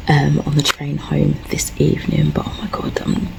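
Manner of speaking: hushed, near-tearful whisper. Real, not acted. At work, confiding to viewers in a whisper. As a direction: keep it down, emotions close to the surface.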